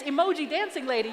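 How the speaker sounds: ordinary talk, nothing marked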